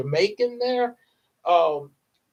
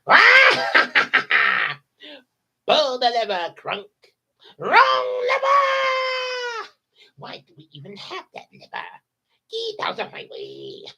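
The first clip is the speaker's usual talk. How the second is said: shrieking, much higher villain voice; Yzma; 13 semitones higher, 4 dB louder, slower than usual. Shown as a demonstration. [name] demos Yzma yelling at Kronk. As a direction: screech orders like a cartoon villainess. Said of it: "I can do Yzma".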